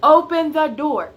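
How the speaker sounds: loud demand through a door; high, loud retold yell. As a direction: shout at the locked door. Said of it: "just yelling at me through the door".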